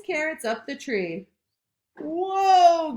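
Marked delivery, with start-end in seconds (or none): 2.0-2.9 higher, louder wobbly whoa; mock groan. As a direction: groan as the branch sways. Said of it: "groaned Tortoise".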